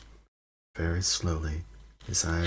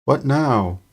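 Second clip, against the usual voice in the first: loud, high desperate call; 8 semitones higher, 10 dB louder than usual. A narrator's reading. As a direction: call out, at a loss. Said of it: "Ron's voice sounding desperate".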